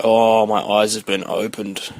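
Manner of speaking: slow, hammed-up voice of revelation; lightly voiced awestruck kid; mock awestruck. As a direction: say it like a mock epiphany, slowly. Kid discovering wrestling game.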